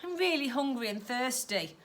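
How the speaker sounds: too weak to argue; tired sighing niece; weary sigh. Acted. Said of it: "Stella sighed".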